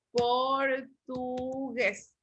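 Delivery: "portugués" very slowly split por-tu-gués; syllable by syllable. Shown as a demonstration. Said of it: "lo separamos en sílaba".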